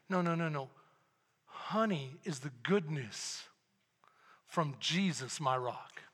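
low, hushed and slow; God gently correcting the pastor, softly. Retold or imitated. God's voice, quoted softly.

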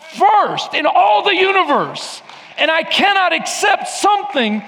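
high, loud shouted voice of God; full-force preaching. Acted. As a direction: thunder God's answer. Preaching God's reply.

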